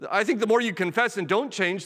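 ordinary delivery

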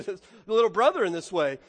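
usual speaking voice

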